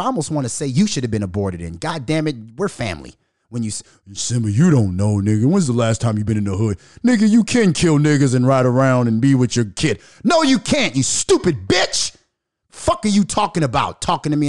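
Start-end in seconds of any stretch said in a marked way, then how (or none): 4.1-6.8 loud dismissive mock of a friend; mocking street friend. Quoting street guys dismissing him, loud mocking. 7.0-14.5 angry rant. Real, not acted. Arguing back at street friends, loud angry rant.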